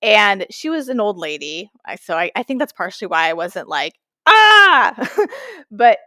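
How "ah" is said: high, loud yelp of alarm; sudden high squeal; mock startled scream. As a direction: shriek like a drink just tipped over. Shock at a spilling drink.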